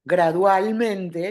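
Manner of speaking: "gradualmente" stretched syllable by syllable; a slow, demonstrating "gradualmente"; slow, drawn out. Shown as a demonstration. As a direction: draw "gradualmente" out slowly to show the change. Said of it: "Como va de joven a viejo".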